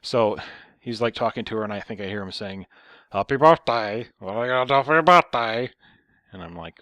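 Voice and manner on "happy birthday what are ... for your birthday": loud, mocking put-on voice; raised caricature of an obnoxious son; annoying son voice. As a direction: mimic an irritating grown son on the phone. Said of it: "talking in this really annoying, slow voice".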